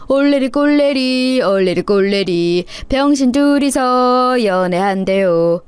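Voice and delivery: sing-song schoolyard taunt, slow; a child jeering in a slow singsong; teasing kids. Acted. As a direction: chant the tease to mock them.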